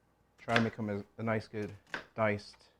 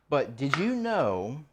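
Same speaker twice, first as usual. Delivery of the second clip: slow teasing; 4 dB louder, much slower than usual. Real, not acted. Teasing fun fact setup.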